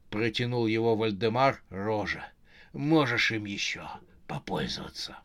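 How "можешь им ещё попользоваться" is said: low, whispered corpse voice; dead husband. Acted. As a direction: whisper it softly from the grave. Corpse hands over hairdryer. (низкий шёпот мертвеца)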